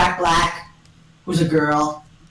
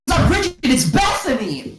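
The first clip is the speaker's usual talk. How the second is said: high-pitched exclamation; mock astonishment; 7 semitones higher than usual. Characters discover who it is, mock astonishment. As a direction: pitch it up in surprise.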